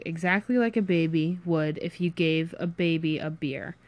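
plain reading voice

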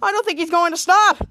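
loud, startled warning; alarmed exclaim. Retold or imitated. Sydney watching the motorcycle, loud alarmed cry. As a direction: blurt it as the bike charges in. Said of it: "Sydney's like".

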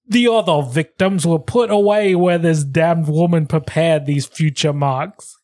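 hammed-up Australian quote reading; amateur Australian, slowing at the end; Australian accent. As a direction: read on in playful Australian. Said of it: "they're from Australia".

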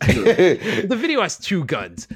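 amused, drawn-out words through a laugh; laughing reply. Real, not acted. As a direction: laugh while setting the record straight.

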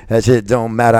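shouted declaration; loud. Acted. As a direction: belt it out. Emphatic repeat, shouted.

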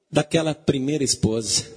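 ordinary delivery

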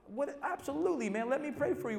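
high, eager reassurance; surprised concern. Retold or imitated. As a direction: jump in, surprised but ready to help.

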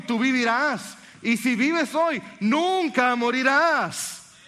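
slow, soaring sermon delivery; fervent preaching. Acted. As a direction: preach it at full force to the congregation. Preacher building to climax.

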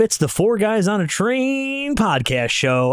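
high, slow showman's call; carnival barker. Acted. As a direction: hawk it like a ringmaster.

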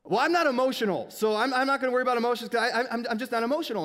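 retelling getting mad at a friend, loud defensive protest; mock angry denial. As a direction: snap back defensively, a bit hammed-up. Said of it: "And I got mad".

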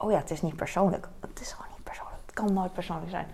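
fading to a soft whisper; whispered reassurance. Real, not acted. Vlogger whispering to herself that comments aren't personal. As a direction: whisper it to calm yourself.

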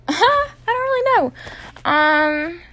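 laughing. Real, not acted. Laughs at herself.

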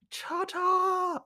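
high, sing-song reveal; hammed-up triumphant flourish; mock unveiling. Acting cooks unveiling burger.

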